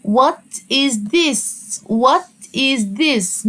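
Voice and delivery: What is this stretched very slowly, this clearly stressed; slow modelled question. Shown as a demonstration. Teacher modelling What is this slowly for first graders. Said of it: "السؤال المشهور".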